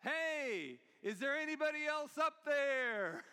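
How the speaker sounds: acted shout from below, last word stretched; shouted back. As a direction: call up past them for someone else. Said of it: "the guy shouted back".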